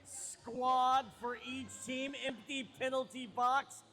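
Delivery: loud, drawn-out arena announcer call; sports announcer. Acted. Announcer setting up the jam, big projected call. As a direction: punch the key words and stretch them.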